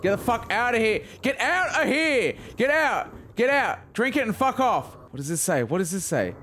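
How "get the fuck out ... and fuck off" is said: much higher strained shouts; high frantic yelling. Real, not acted. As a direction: yell the enemy away in panic. Yelling at enemy while healing.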